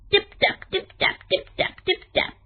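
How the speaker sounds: first tap high, then whispered taps; little feet pattering in the dark; tiny tapping noises. A narrator's reading. Little noises mice make.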